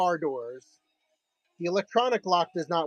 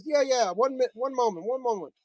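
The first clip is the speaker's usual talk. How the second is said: put-on light Spanish accent; 6 semitones higher than usual. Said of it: "So he goes".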